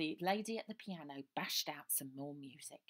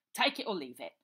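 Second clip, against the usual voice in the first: curt landlord; 11 dB louder than usual. Acted. Landlord dismissing girl.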